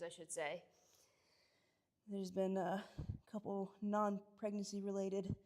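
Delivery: ordinary talk, nothing marked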